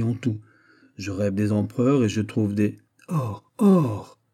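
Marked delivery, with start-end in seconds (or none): 3.1-4.2 crying out. A narrator's reading. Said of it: "s'écria Lavallière".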